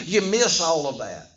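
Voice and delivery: ordinary talk, nothing marked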